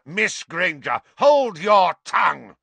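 high, loud, weighted command; angry rebuke. A narrator's reading. Professor silencing girl mid-sentence, angry. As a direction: cut her off furiously.

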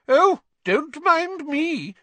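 high, slow and sarcastic portrait lady; huffy painted lady calling after them; irritable fat lady. A narrator's reading. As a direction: drag it out in wounded sarcasm. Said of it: "the fat lady called irritably".

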